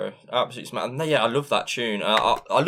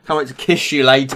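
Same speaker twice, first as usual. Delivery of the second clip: mock flirty; 8 dB louder than usual. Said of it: "didn't take you long to get weird".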